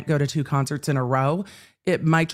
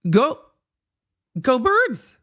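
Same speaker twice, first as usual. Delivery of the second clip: louder higher gleeful cheer; root for the Eagles out loud; go Birds cheer; 6 semitones higher, 4 dB louder, slower than usual. Real, not acted. Fan gloating to Kansas City viewers, excited cheer.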